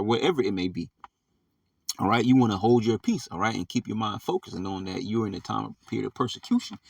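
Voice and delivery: normal talking voice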